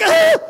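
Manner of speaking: very high, loud shriek at a mouse; mock shriek. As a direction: let out a sudden squeal. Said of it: "It's like".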